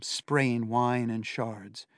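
plain reading voice

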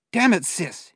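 angry hissed curse. A narrator's reading. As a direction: spit the curse out in pain. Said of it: "he hissed".

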